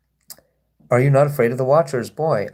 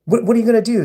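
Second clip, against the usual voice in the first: high, goading sing-song; baiting taunt; 8 semitones higher, 3 dB louder, much faster than usual. Real, not acted. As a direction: egg him on, voice up high. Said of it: "I'm just baiting them".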